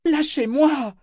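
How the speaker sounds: high, desperate shout; desperate cry. A narrator's reading. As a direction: cry out to break free. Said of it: "clama-t-elle désespérément".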